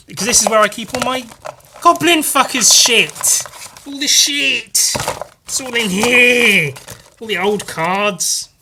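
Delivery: a goblin hoarding his treasure; high excited show-off voice; high, gleeful reveal. Acted.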